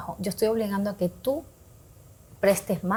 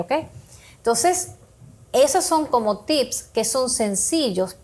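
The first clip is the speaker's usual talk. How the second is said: ordinary delivery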